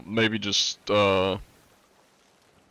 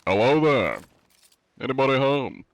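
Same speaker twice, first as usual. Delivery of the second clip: posh greeting, louder, then high; fancy posh voice; 6 semitones higher, 5 dB louder than usual. Shown as a demonstration. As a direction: call out grandly like a gentleman. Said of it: "When I'm not talking fancy".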